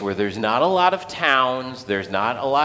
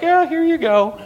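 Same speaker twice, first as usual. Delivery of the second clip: put-on much higher, eager child's voice; little kid voice; 4 dB louder than usual. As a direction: pipe it up like a small boy. Kid handing over his lunch.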